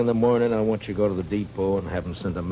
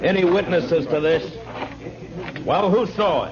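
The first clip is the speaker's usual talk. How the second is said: demanding; 10 semitones higher, 4 dB louder than usual. Acted. Marshal at murder scene.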